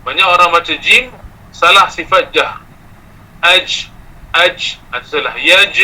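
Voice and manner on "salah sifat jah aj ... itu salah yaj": ordinary talk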